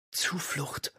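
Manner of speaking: breathy whisper of one word; whispered secret answer. A narrator's reading.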